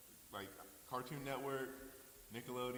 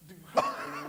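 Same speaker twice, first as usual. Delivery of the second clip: high, loud teasing laugh; laughing tease; 9 semitones higher, 15 dB louder than usual. Real, not acted. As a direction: land the jab with a laugh.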